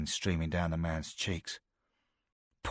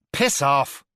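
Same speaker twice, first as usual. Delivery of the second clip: loud, high, venomous spit of a curse; spat curse; 8 semitones higher, 8 dB louder than usual. A narrator's reading. Beaten Scouser spits defiance at his captor, loud and venomous. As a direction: fling it at him in defiance. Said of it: "he spat".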